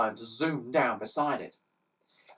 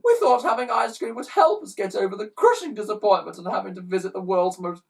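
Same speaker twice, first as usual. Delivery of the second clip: put-on high loud child bawling; hammed-up bawling schoolchild; 7 semitones higher, 7 dB louder than usual. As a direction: howl out the disappointment. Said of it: "bawled a fourth".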